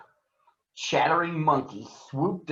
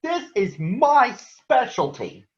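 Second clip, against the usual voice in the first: higher, slow, cocky scarecrow voice; a lightly voiced eager scarecrow; proud Scarecrow; 10 semitones higher, slower than usual. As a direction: brag like a straw man ready to scare. Scarecrow boasts.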